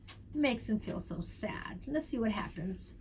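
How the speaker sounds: put-on hushed whisper to the kids; soft secret whisper to young listeners. Shown as a demonstration. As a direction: lean in and whisper it. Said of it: "whispers to children".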